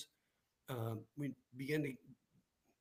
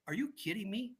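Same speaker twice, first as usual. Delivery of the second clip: higher, surprised question; a light imitation of his own astonishment; mock disbelief; 8 semitones higher than usual. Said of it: "I said".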